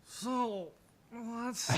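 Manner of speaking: put-on sway into it like a drunk. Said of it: "he's just like".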